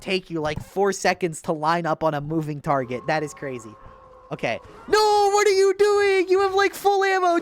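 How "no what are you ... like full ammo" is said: higher, louder disbelief; exasperated yell. Real, not acted. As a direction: shout in frustration at the player. Exasperated at a bad player.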